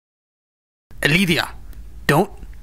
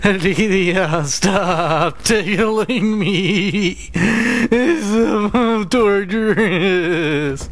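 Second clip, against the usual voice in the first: laughing through tickles; slow, squirming, laughing protest; being tickled; 3 semitones higher, much slower than usual. Real, not acted. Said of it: "tickling him right now".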